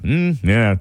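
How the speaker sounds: lightly voiced calm engineer; low, understated hum of agreement. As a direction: imitate an engineer calmly mulling it over. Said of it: "they were doing the engineer voice".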